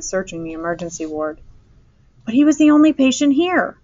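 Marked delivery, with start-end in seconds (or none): 2.3-3.8 loud, high-pitched angry protest. A narrator's reading. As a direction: protest angrily, voice raised high. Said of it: "Mister Eberhardt said angrily".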